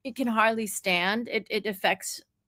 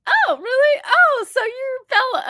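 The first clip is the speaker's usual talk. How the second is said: put-on high, loud "oh, really?" to children; acted surprise; 8 dB louder than usual. Woman humouring two kids, high exaggerated surprise. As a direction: play up polite amazement, pitched way up.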